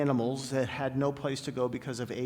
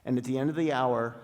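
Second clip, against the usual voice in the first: ordinary talk, nothing marked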